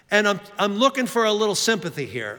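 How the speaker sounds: normal talking voice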